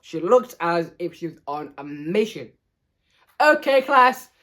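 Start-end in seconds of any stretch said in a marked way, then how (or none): 3.4-4.3 bright, high, loud classroom call; raised teacher voice. A narrator's reading. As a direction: get the class's attention. Teacher calling the class to attention.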